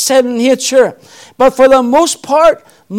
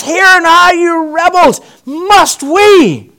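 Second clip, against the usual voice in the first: higher, louder furious cry of Hear now; enraged Moses; 6 semitones higher, 4 dB louder, slower than usual. Acted. Moses in a rage shouting at the rebellious people. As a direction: bellow at the rebels like a man in a rage. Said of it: "He's in a rage".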